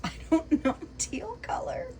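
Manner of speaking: laughing. Real, not acted. Amused by husband's teal paint.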